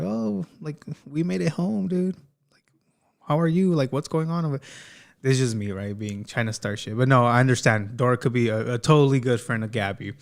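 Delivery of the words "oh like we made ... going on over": high-pitched, put-on chatty voice; mock friendly caller. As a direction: play a nosy friend checking in, voice lifted high. Host imagines Dora's nosy call.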